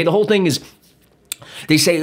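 normal talking voice

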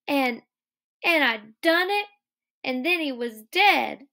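acted upset simple man, high voice; Lennie distressed.

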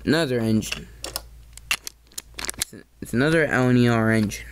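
ordinary talk, nothing marked

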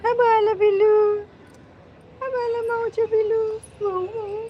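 put-on high sing-song baby talk; baby talk to kitten.

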